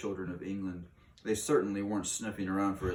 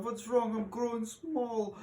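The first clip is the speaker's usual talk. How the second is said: much higher, slow tiny voice; boy crying out. Acted. Boy shrinking into a mouse cries out, high tiny voice. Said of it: "cries out".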